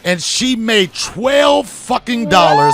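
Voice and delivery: loud, booming announcer outro; show host sign-off. Acted. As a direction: close the show like a hype host.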